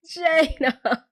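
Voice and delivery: high, wailing cry of the name; mock wail. Real, not acted. Vlogger still grieving the character, a high wail. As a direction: call after her, half laughing.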